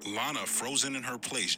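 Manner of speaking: plain reading voice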